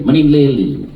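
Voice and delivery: lightly put-on Chinese accent; imitated Chinese accent. Nail lady introducing herself, imitated Chinese accent. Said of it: "That's her accent".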